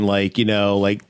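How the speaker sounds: usual speaking voice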